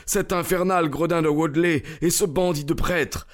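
high, agitated urging; desperate plea. A narrator's reading. Stranger begging Holmes to help save her, desperate plea. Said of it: "au comble du désespoir".

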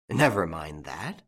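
ordinary delivery

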